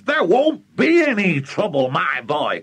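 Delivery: high, emphatic interruption; overexcited father waving away doubt; excited cry. Acted. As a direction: cut in high and confident. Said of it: "cried Mister Cranky".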